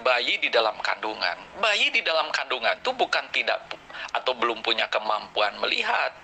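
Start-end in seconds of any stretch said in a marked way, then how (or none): none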